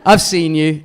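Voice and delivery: loud laughing jab, I've seen you; hammed-up accusation through a laugh; mock accusing shout. Retelling a comic shouting at an audience member, mock accusing. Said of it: "and he goes".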